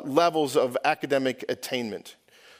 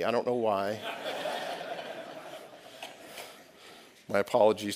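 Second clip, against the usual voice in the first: said through a real chuckle; amused, a little sheepish; laughing; 5 semitones lower, 7 dB quieter than usual. Real, not acted. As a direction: laugh at yourself softly as you say it. Preacher laughs at himself.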